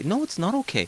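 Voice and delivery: a playful stern correction; retold firm no. Broker retells telling a client off, mock stern.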